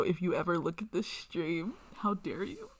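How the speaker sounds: normal talking voice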